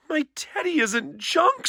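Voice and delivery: a mild, hammed-up cub protest; little sister screaming. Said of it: "screamed Sister".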